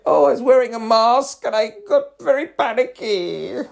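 high, loud mocking whine; demeaning whiny voice. Mocking a panicky drama queen in a demeaning voice. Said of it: "that demeaning voice".

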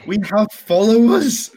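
high, loud incredulous question; astonished. Real, not acted. Hearing the podcast has Twitter followers, astonished. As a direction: blurt it out, pitch shooting up.

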